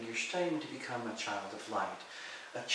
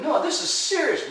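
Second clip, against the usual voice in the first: higher and louder, laughing through the words; laughing protest; 12 dB louder than usual. Retold or imitated. Sister on the phone, laughing while insisting it's serious. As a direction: try to sound serious but crack up. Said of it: "she's laughing and going".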